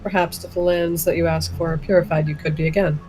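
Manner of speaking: usual speaking voice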